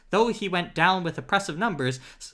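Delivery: ordinary delivery, nothing marked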